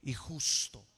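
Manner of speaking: preacher roaring to the congregation; fiery preaching climax. Acted. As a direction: shout the promise to the congregation. Preaching climax at full force.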